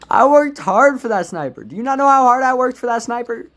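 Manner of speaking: high, loud indignant complaint; indignant rant. Real, not acted. Gamer ranting at the teammate who stole his sniper, indignant. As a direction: protest the unfairness loudly.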